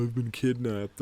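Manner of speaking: mock-whine the captured boy; whiny kid. Mocking Michael giving up hope, mild whine. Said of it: "He's all whiny and complaining".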